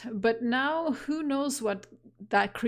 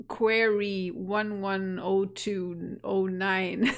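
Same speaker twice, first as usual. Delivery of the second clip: ordinary delivery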